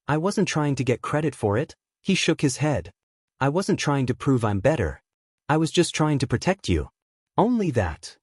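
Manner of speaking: ordinary delivery, nothing marked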